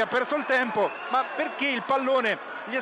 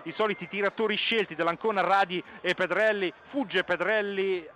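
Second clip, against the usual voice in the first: ordinary delivery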